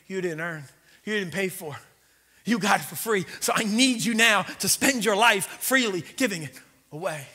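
usual speaking voice

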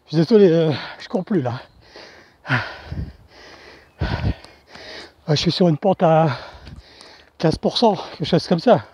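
out of breath on a climb; panting up a steep hill; while running. Real, not acted. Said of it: "ça grimpe".

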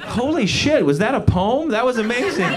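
high-pitched, blown-away reaction; amazed. Retold or imitated. Comic reacting to the old lady's line, amazed. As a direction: gush as if you just heard a masterpiece.

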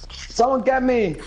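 ordinary delivery

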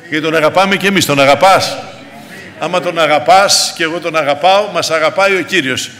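emphatic pulpit exhortation; exhorting preaching. Acted. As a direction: urge the congregation on with force.

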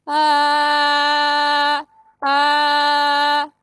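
bright ringing ah; twang ah. Shown as a demonstration. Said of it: "y ahora le voy a poner brillo".

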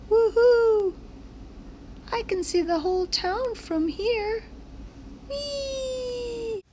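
high whooping cheer; high woohoo and whee; thrilled coaster riders. Acted. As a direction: scream with joy on the ride. Roller coaster fun.

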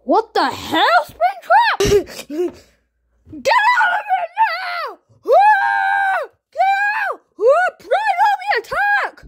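loud, high panicked yelling; frantic high yells to chase him off; high shouting. Acted. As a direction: drive him out with screams. Chasing Springtrap away.

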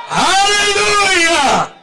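long, high, loud Hallelujah; drawn-out high preacher's cry; rousing shout. Real, not acted. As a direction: shout it out to wake up the congregation. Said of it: "I didn't hear you".

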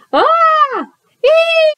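high-pitched squealed "ah" and "ee"; two short, piercing frightened shrieks; scared scream. Acted. Reader voices a scaredy cat kid's fright, high scared squeals.

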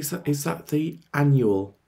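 ordinary talk

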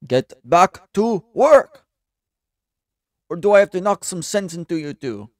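acted bossy yell at underlings; angry boss. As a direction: shout the order word by word. Boss scolds two underlings.

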